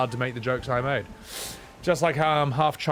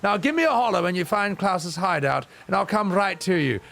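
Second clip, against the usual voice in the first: put-on snooty old lady; 5 semitones higher than usual. English old lady thanking the filthy Scot, pitched high and smug. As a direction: purr the insult like a smug granny.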